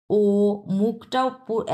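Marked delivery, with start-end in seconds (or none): 0.1-0.2 "ও" with fully rounded lips. Shown as a demonstration. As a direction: say "ও" as a long, round o. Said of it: "মুখটাও এরকম round shape হতে হবে".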